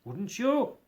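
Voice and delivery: lightly voiced old Scrooge, curious; a hint of a wary old miser asking. A narrator's reading.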